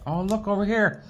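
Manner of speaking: usual speaking voice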